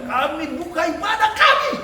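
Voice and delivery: hammed-up loud, high opening call, laughing; mock a leader yelling to open the service; a laughing parody of shouting. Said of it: "Teriak-teriak".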